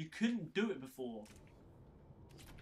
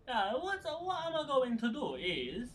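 playful mock Italian accent, higher and louder; 9 semitones higher, 5 dB louder, slower than usual. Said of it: "talking with the Italian accent".

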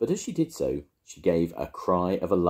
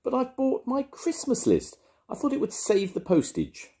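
hotly; 3 dB louder than usual. A narrator's reading. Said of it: "repeated Paddington hotly".

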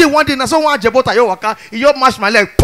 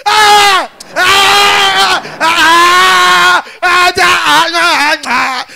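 shouting ah; 6 semitones higher, much slower than usual. Retold or imitated. Shouting in the principal's office, unable to explain. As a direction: cry out helplessly, no words. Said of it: "The only thing I dey shout out".

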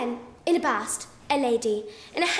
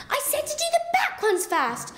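"back ones first" called out much higher; calling out; 11 semitones higher than usual. Acted. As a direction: crocodile calls out impatiently, high. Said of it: "the crocodile called out".

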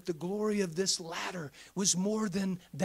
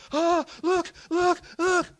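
mock panic; 11 semitones higher, 12 dB louder, slower than usual. Mocking fearful saints looking down.

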